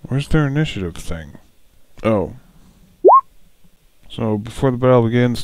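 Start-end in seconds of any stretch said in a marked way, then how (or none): none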